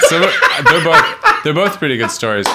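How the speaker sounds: laughing. Real, not acted. Laughing while choosing a story.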